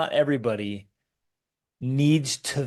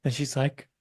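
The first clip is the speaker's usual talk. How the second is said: ordinary talk, nothing marked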